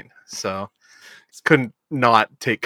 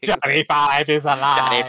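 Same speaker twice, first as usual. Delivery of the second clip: robot voice; 2 semitones higher, slower than usual. Retold or imitated. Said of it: "This isn't Short Circuit".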